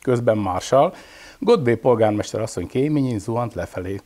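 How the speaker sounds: usual speaking voice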